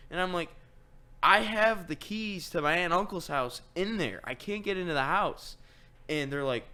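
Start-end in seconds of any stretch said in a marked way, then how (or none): 1.2-5.6 high, loud retold complaint; exasperated protest. Son telling mom the house keys are gone, exasperated. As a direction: protest in alarm, pitched up and pushed.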